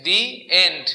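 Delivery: "the end" as DEE, pause, end; thee before vowel. Shown as a demonstration. Teacher giving students the answer, "the end" as dee end. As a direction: split "the end", article with a long ee. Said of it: "ভাওয়েলের আগে থাকলে কি হয় উচ্চারণের".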